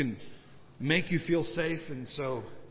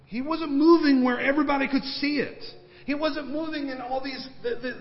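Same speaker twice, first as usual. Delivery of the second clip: preaching shout; 10 semitones higher, 6 dB louder than usual. Acted. Preaching climax, loud and high.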